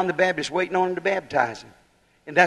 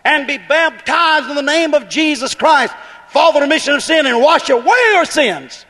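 loud command; 6 semitones higher, 4 dB louder than usual. Acted. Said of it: "He said".

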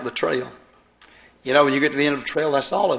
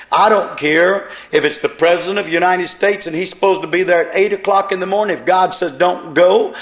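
loud, higher preaching voice; fiery preaching; 4 semitones higher, 5 dB louder than usual. Acted. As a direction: pound it home to the congregation. Preacher at full force.

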